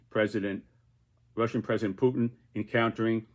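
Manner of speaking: ordinary talk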